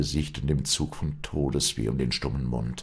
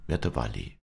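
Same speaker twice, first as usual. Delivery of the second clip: ordinary delivery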